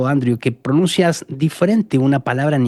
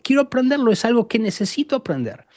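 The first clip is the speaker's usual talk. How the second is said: eager learner; 6 semitones higher than usual. Retold or imitated. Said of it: "cómo tú dices".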